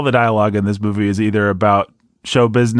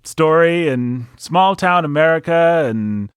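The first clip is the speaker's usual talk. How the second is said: hammed-up patriotic hero; 7 semitones higher than usual. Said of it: "it has the gall to start with".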